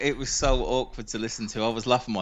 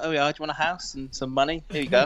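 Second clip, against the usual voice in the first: higher, breezy mock official voice; mock the officials handing out houses, higher; mock generous official; 5 semitones higher than usual. Mocking welcoming officials.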